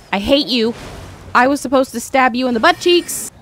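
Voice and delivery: ordinary delivery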